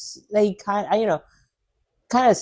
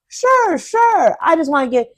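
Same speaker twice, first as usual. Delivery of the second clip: squeaky sure; 9 semitones higher than usual. Retold or imitated. Flustered high sure.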